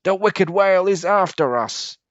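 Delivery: high, loud panicked fish voice; panicked alarm. Acted. Jagged-fin fish warning the others, high and loud. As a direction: cry out in fear to the school. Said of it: "sounded the alarm".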